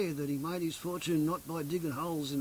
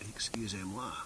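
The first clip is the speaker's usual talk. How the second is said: unvoiced whisper in French; a hushed French apology; whispered excuse me; 7 semitones lower, 5 dB quieter than usual. Real, not acted. Whispered apology after coughing.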